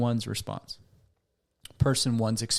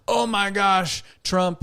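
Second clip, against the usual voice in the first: much higher, louder mock outburst; big reaction; 8 semitones higher, 4 dB louder, slower than usual. Acquaintance hearing politics mentioned, hammed-up outburst. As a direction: overreact like a small-talk hothead. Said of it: "they get a big reaction".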